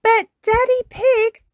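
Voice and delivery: very high, loud protest; Peppa Pig. Acted. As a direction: whine up high like an objecting child. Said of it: "says Peppa".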